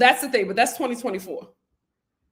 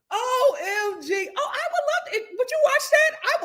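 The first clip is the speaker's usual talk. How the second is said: excited; 13 semitones higher than usual. Real, not acted. Reacting to chat, high squeal.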